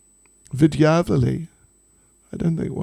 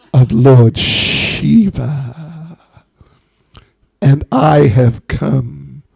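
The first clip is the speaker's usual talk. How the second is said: very slow, drawn-out sibilant snake; menacing snake voice; much slower than usual. Acted. Snake of Lord Shiva threatening an old woman, sinister. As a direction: stretch every word with long snaky esses. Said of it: "comes the voice of the snake".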